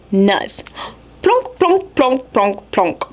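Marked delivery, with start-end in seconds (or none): none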